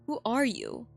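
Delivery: ordinary talk, nothing marked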